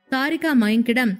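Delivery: plain reading voice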